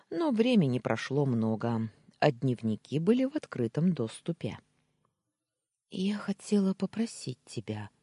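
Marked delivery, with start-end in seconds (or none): 5.9-7.9 higher, hesitant request. A narrator's reading. Wife timidly asking her husband for a favour, hesitant. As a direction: ask shyly, a touch unsure. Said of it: "замялась Юля".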